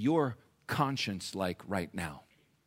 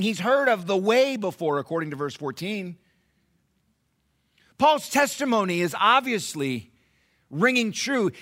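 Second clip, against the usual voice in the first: raised, high-pitched sermon delivery; emphatic preaching; 11 semitones higher, 9 dB louder than usual. Real, not acted. Preacher telling church of Felix's bothered conscience, emphatic. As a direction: preach it hard, punching the key words.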